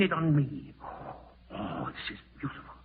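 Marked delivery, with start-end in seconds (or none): 1.5-2.7 whispered gloat, lower. Acted. Man gloats to himself over his plan, whispering. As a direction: savour it under your breath.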